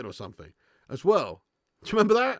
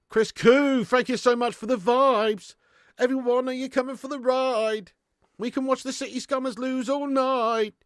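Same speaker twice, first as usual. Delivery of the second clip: excited shout-out; 9 semitones higher, 5 dB louder than usual. Real, not acted. Streamer shouting out chat donor.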